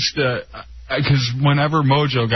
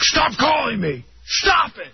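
high-pitched mock screaming; imitated angry scream; 13 semitones higher than usual. DJ screaming at repeat prank callers, imitated. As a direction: act out a pissed-off DJ at the end of his rope. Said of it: "Just screaming".

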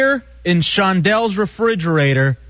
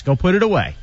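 ordinary delivery

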